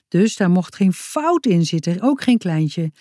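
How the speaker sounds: ordinary delivery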